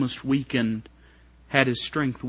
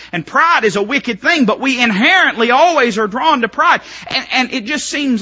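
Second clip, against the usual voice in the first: preaching swell; 9 semitones higher, 6 dB louder than usual. Acted. Preaching climb.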